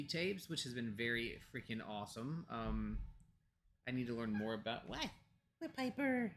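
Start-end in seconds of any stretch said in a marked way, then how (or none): 4.9-6.3 high, sing-song voice for the dog; pet voice. Real, not acted. Owner calling to her dog Piper in a high pet voice. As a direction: coo up at the pup in a much higher voice.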